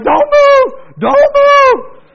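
very high, full-volume panicked yell; reenacted shrieking command. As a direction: scream it at a child in a freak-out. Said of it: "Now, I'm yelling".

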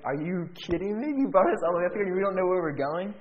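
high, loud outraged disbelief; hammed-up retold exasperation; incredulous. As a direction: protest it, pitched right up. Incredulous at having no plan.

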